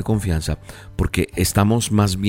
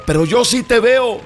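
father calling; slower than usual. Acted. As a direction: call up steady and urgent. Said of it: "El papá le decía". (padre gritando con ánimo)

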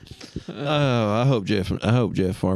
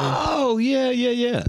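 excited recognition; 14 semitones higher, much slower than usual. Real, not acted. Sudden recognition of Ken Bone.

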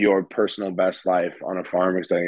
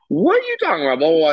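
put-on high-pitched country girl's Southern twang; mock-Southern protest, high and loud; thick Southern accent; 8 semitones higher, 5 dB louder, much faster than usual. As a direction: imitate an indignant Southern teen, pitched way up. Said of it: "in her just deepest, thickest Southern accent".

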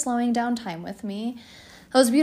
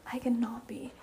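laughing whispered aside; 8 dB quieter than usual. Real, not acted. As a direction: whisper it under your breath.

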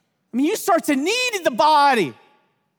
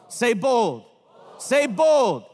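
called out; 3 semitones lower, much slower than usual. Real, not acted. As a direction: lead the chant, say bold.

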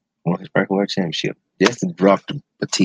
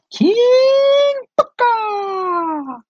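put-on high, very drawn-out British royal accent; mock British accent, slow regal proclamation; King Booker; much slower than usual. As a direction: stretch it out long and high like a pompous British king. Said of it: "He had an accent like he was a king".

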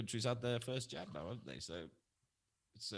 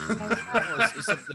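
laughing; 12 semitones higher, 11 dB louder, slower than usual. Real, not acted. Laughing as he starts reading.

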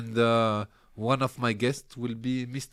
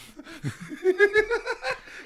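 loud high laugh; 8 dB louder than usual. Real, not acted. Big laugh after story.

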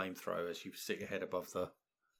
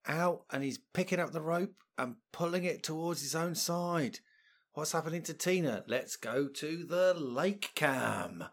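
lively live play-by-play, raised pitch; breathless gladiator-show commentary; sports commentator; 6 semitones higher, 5 dB louder, slower than usual. Acted. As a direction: call the action like a TV sports commentator.

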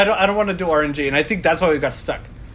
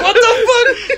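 high, loud laughing disbelief; mock-shocked shout breaking into laughter; shocked outburst; 5 dB louder, slower than usual. Retelling his shock at his rival's gym progress, high laughing outburst. Retold shock at friend's early call.

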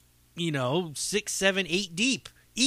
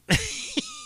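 short high giggle; 4 dB quieter than usual. Real, not acted. Laughing before changing topic, high giggle.

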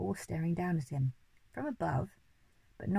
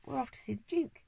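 high, eager call; monkey crying out gleefully; excited cry; 7 semitones higher than usual. A narrator's reading. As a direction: cheer the news out. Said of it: "cried the monkey".

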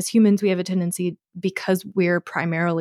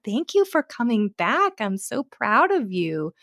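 excited praise; 9 semitones higher than usual. Retold or imitated. Praising returning puppies.

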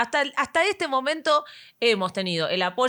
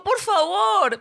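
raised high desperate plea; pleading shout; 8 semitones higher, 4 dB louder, much slower than usual. Retold or imitated. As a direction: beg loudly, near yelling.

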